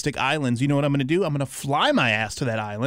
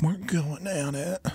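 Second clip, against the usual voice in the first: slow, soft suggestive mimicry; mocking co-host's phrasing; 6 dB quieter, slower than usual. Podcaster mimics how co-host says it, mocking. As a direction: draw it out slowly and softly, imitating him. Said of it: "the way you say it".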